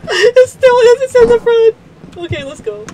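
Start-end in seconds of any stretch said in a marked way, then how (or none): 0.1-1.8 high, loud frightened callout; panicked. Real, not acted. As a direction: call it out in a panic. Panicked callout in dark game.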